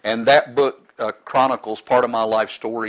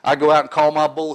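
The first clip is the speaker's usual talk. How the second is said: ordinary delivery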